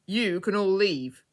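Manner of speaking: loud, slow commanding dismissal; authoritative. A narrator's reading. Mister Discover the Truth dismisses the crowd, authoritative. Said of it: "in such an authoritative voice".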